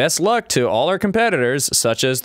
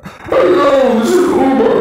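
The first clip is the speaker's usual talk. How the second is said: high, loud put-on robot greeting; self-driving car's robot voice, much higher and louder; 12 semitones higher, 8 dB louder, slower than usual. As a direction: play a goofy AI car saying hello. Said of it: "it'd be like".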